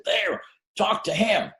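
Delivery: high, slow quoted retort; arguing subway guy. Retold or imitated. Arguing man points out a bystander to his mate, high and drawn out. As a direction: imitate a man singling someone out, pitched well up. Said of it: "pointed at me directly and said".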